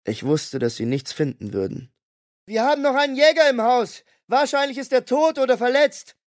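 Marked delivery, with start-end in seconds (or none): 2.4-6.2 much higher, urgent yelled report; young woman calling out over the noise; Julie yelling. A narrator's reading. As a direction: shout it across to the agents. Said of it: "brüllte Julie den Bundesagenten zu".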